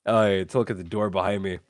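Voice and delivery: ordinary talk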